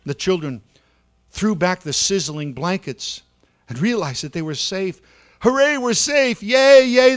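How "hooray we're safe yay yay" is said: high, loud cheering; high, loud children's cheer of relief; joyful shout. A narrator's reading. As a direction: shout for joy at being saved. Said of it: "they shouted".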